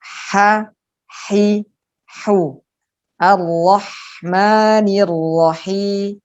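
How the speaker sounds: breathy mid-throat ha, hi, hu; throat ha sounds. Shown as a demonstration. Teacher drilling Quran letters, breathy throat ha. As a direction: push the ha from the middle of the throat. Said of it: "Jadi tenggorokan bagian tengah".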